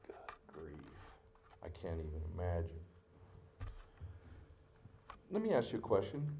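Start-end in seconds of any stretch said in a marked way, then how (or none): 0.1-1.0 breathless whispered aside; real whisper. Real, not acted. Preacher to the congregation, overcome, whispering. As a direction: whisper it, overwhelmed by the scene. Moved by Peter's look.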